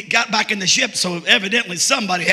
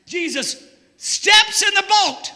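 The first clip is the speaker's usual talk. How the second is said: full-force preaching; 8 semitones higher, slower than usual. Acted. Preacher shouting the story of Peter to his church. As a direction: preach it out to the rafters.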